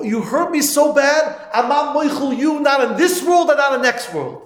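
high, loud, slow wounded protest; hurt and bitter. Retold or imitated. Wronged bocher to the prankster, pained and loud. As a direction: pour out the hurt.

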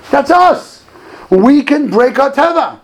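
high, loud rallying cry; impassioned preaching. Real, not acted. Rabbi rallying his listeners to overcome nature, impassioned preaching. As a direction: drive it home, voice lifted.

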